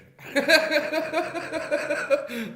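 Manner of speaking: loud, high-pitched cackle; big laugh. Real, not acted. Comic gloating over a rival, loud high cackle.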